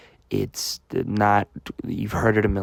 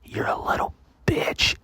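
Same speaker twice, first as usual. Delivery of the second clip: Roger's taunting whisper. Retold or imitated. Classmate sitting right behind him needles him, whispered. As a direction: whisper it meanly into someone's ear. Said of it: "I got Roger from behind me".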